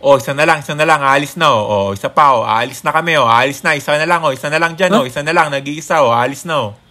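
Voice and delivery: barker calling out passengers; jeep barker. Acted. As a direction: hawk riders for the jeep.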